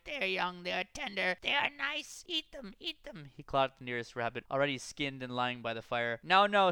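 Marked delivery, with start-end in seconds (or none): 0.0-3.3 very high, slow greedy creature voice; coaxing Gollum voice. A narrator's reading. Gollum praises raw rabbits. 6.2-6.7 loud, firm now now; firm hobbit. A narrator's reading. Sam calms Gollum.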